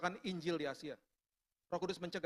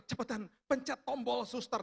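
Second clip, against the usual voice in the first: hurried wife's higher-pitched urging; urgent retold plea; 6 semitones higher than usual. Wife to husband as the heat rises, urgent. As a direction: imitate a wife pressing for quick help.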